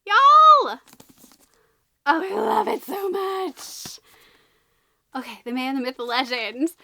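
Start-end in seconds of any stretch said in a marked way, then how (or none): 0.1-0.8 loud, high call to viewers; excited shout. Real, not acted. Book fan calling to viewers about Nessie, excited shout. 2.1-4.0 high, slow, drawn-out gush. Real, not acted. Book fan adoring her new book, gushing.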